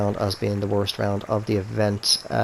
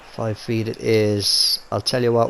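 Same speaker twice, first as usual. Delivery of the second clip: ordinary talk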